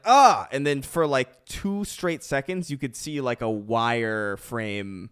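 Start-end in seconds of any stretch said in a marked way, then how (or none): 0.0-0.5 short, loud, high yelp; sudden acted yell on impact; imitated cry of pain. Getting hit in the head by a thrown object, pained yelp. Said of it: "you get hit in the head, and you're like".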